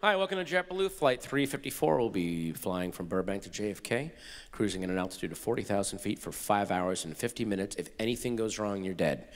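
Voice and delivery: bright-pitched greeting dropping to a low drone; mock safety announcement. Crew telling passengers if anything goes wrong they're dead, low announcer drone. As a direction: reel off a cabin announcement low and matter-of-fact. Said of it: "That should be the safety announcement".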